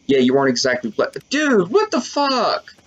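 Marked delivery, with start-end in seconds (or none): 1.3-2.7 high, drawn-out shock; long, high-pitched what-on-earth reaction; startled disbelief. Real, not acted. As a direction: stretch it out high in sheer disbelief. Startled mid-reading by game.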